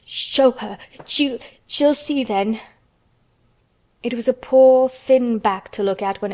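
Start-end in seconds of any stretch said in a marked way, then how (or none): 0.0-2.6 slow, broken, stammered plea; gasping between sobs. A narrator's reading. Sobbing boy lets the nurse show his back, gasping. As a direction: gasp it out mid-sob. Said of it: "he gasped out between two sobs".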